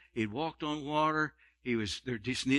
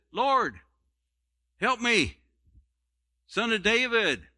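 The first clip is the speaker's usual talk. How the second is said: raised, pleading cry for help; crying out, begging; 6 semitones higher, slower than usual. Acted. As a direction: beg him desperately, a little higher. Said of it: "She's crying after him".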